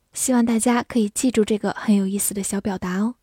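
usual speaking voice

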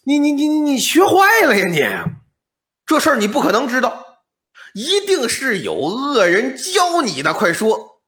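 put-on old man shouting in alarm; alarmed angry shout. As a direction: roar it out in shocked fury. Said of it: "老翁是大惊失色".

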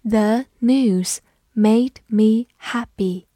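slow, careful model reading; teacher reading slowly for the class. Shown as a demonstration. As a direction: read it slowly word by word. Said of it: "慢慢来读".

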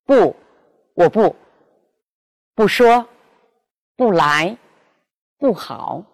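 不 said with its own falling fourth tone; falling 不 before each non-falling syllable; bu in original tone. Shown as a demonstration. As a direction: keep 不 falling in 不说 and 不来. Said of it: "仍读本调".

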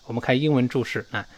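usual speaking voice

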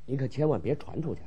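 very soft, low warning; furtive, barely voiced confiding; hushed secret. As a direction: swear the listener to secrecy under your breath. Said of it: "大点声说".

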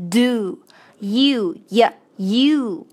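"do" and "you" sounded out slowly with a clear voiced d; voiced consonant demo. Shown as a demonstration. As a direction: drill the syllables "do" and "you". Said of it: "这个浊辅音的".

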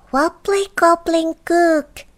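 high-pitched goblin incantation; high goblin spell; goblin magic word. Acted. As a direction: chant it in a high little voice. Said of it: "he said a magic word".

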